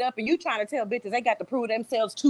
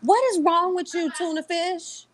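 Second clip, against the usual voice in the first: high, mocking put-on voice; mocking impersonation; 7 semitones higher than usual. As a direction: keep mimicking her, pitched up. Continues the impersonation.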